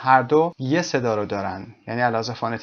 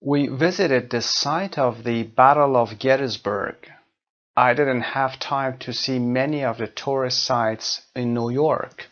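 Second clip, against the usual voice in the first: slow, carefully spaced example sentences; slow example read; much slower than usual. Shown as a demonstration. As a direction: read the examples slowly so learners hear each word.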